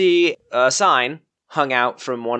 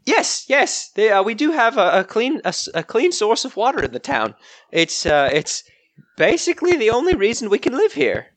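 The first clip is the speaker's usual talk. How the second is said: higher, flustered shopkeeper woman; Dee the shopkeeper; 7 semitones higher than usual. Acted. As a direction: play the startled village shopkeeper.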